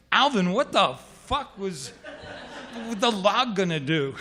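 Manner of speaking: loud, high, incredulous demand; exasperated disbelief. Retold or imitated. Swimmer confronts his buddy at the shoreline, loud laughing disbelief. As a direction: demand it loudly, half laughing.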